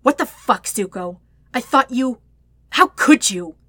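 loud, wounded outburst; hurt and betrayed. A narrator's reading. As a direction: break off, then burst out accusingly. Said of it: "to sound so hurt or betrayed".

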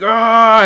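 high, loud battle cry; rage yell. Pretend. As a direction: let out a raging barbarian roar. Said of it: "a barbarian, like, raging".

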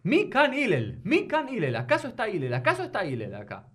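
high calling out at the door. Acted. Man at Hillel's door calling for him, high call. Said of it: "empezó a gritar".

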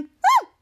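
short, very high loud wail; high-pitched mock howl; imitated wail. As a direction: mimic a shrieking wail. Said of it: "And there was wailing".

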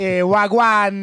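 loud, drawn-out mock Jamaican greeting; hammed-up slow Jamaican accent. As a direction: stretch it out in Jamaican patois. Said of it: "When I do Jamaican".

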